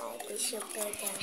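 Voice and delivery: hushed whisper while picking. Real, not acted. Girl whispering as she picks her paper. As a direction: whisper it, tense and careful. Nervous pick from cup.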